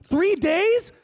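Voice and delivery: high, rising disbelief; incredulous. Acted. Spouse objecting to being left, incredulous. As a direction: pitch it up in shocked disbelief. Acted spouse's shocked reply.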